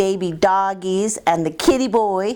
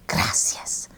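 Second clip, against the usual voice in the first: whispered; 6 dB quieter than usual. Acted. Dog thanks after sneeze.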